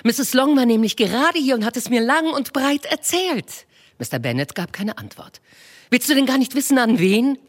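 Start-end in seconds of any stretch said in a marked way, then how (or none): 0.0-3.6 Mrs Bennet voiced high and bright-eyed, fussing; a high, eager matron gushing to her husband; eager wife. Wife to her husband with news, eager high voice. 5.9-7.4 high, impatient wife pressing for an answer. Acted. Wife impatiently urging her silent husband, high and pressing. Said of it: "rief seine Frau ungeduldig".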